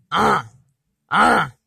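ah with the vowel through the nose; nasal A. Shown as a demonstration. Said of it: "the nasal A".

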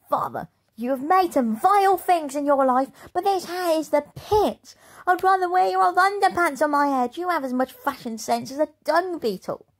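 high, loud contemptuous tirade; scornful insults. Acted. Rude daughter insulting her father's new hat, high and loud.

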